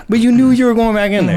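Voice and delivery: high, loud mock insistence; insistent buddy. Buddy overruling the fighter's excuse, loud and insistent. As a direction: drive the point home like a badgering friend.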